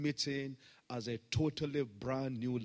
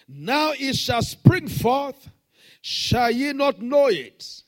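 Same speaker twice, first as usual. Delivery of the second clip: shouted, much higher scripture proclamation; full-force scripture reading; 9 semitones higher, 14 dB louder than usual. Acted. Pastor proclaiming the verse to the congregation, shouted and high. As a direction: cry the verse out, slowing on the key lines.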